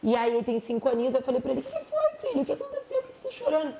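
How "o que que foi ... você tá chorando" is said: put-on high, tender question to a crying child; tender mother voice. Mother asks her crying son what happened, high and tender. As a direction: coax a crying little boy gently.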